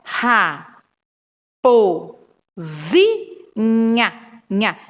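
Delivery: Ra, po, zi, nha spoken as separate beats with pauses between; teacher sounding out a word syllable by syllable. Shown as a demonstration. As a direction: sound out each syllable clearly for a child. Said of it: "ó".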